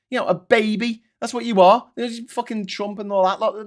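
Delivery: normal talking voice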